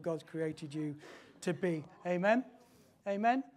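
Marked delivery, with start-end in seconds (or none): none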